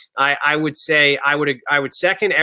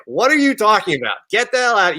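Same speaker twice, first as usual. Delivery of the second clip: higher-pitched imitation of an outraged mother; indignant mom; 7 semitones higher than usual. Mom to her presumptuous child, mock indignation. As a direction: play a mom's incredulous rebuke. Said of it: "She would be like".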